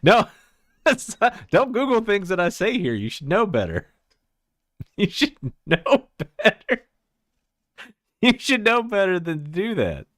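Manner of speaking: high, loud protest through laughter; squealed, giggly telling-off; laughing scolding. Real, not acted. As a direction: scold them while cracking up. Scolds viewers while laughing.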